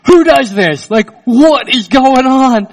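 high, loud, stunned disbelief; amazed kids. Retold or imitated. Kids stunned by the stranger's cash, loud amazed cry. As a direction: gasp it out like astonished children.

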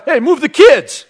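loud high call across the pool; calling out to wife. Pretend. Husband calling loudly to wife across pool. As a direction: holler to clear the kids out of the way.

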